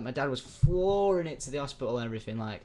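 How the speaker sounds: usual speaking voice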